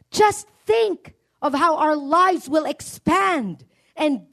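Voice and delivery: high, slow, ringing preacher's voice; preaching at full force. Acted. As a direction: rouse the congregation, voice lifted.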